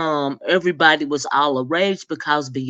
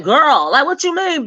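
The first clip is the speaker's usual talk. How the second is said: incredulous sass; 9 semitones higher, 3 dB louder than usual. Pretend. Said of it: "And I'm like".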